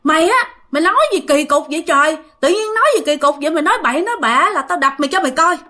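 fast, high-pitched mock telling-off; retold scolding. Mother threatens to smack brother-in-law for talking nonsense, fast retold scolding. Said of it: "mẹ mới la".

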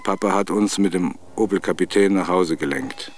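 normal talking voice

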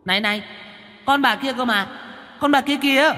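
high, hushed little ghost voice; a scared little spirit pointing the mother away; startled child ghost. Acted. Frightened child ghost protests.

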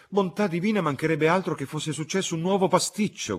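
a mother crying out in dismay; shrieking alarm, high and loud. A narrator's reading. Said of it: "gridò la signora Honeychurch".

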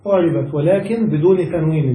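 ordinary talk, nothing marked

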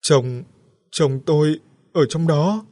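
slow, broken, high and loud; halting frightened words. A narrator's reading. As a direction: gasp out each word in shock. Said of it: "nói từng tiếng rời rạc". (nói từng tiếng rời rạc, hoảng sợ)

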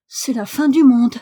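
loud, stunned exclamation; flabbergasted, emphatic outburst; uttered in shock. A narrator's reading. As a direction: pronounce it in sheer astonishment. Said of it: "articula Martha".

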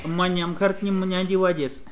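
ordinary talk, nothing marked

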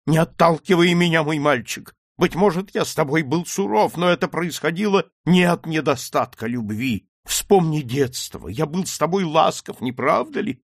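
earnest high-pitched entreaty; pleading father. Acted. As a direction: beg a son not to reject you. Old father begs his son.

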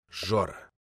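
put-on low hoarse drunken whisper. A narrator's reading. Drunk poet whispers his name to the narrator. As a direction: breathe it out drunk and close.

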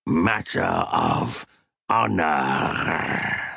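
low, slow alien voice, grudging; reluctant. A narrator's reading. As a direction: drag the words out unwillingly. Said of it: "Snowy said reluctantly".

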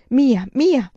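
loud, high cry of guilt; emphatic, distraught repetition; anguished self-blame. Acted. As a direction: cry it out against yourself. Girl blames herself, beating her forehead.

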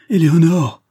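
loud, high cry of her name; cri d'appel. Acted. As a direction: call after a departing lover in desperation. Il la rappelle, désespéré. (cri désespéré, voix haute et forte)

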